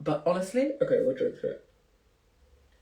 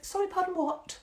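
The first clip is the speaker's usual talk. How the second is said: high-pitched mock disbelief; taken aback; 12 semitones higher than usual. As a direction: reenact a startled double take, voice shooting up. Retelling own shocked reply.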